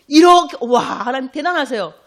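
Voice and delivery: loud, high wow of awe; big demonstrative admiration; acted amazement. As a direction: burst out marveling at God's greatness. Acting out awe at God.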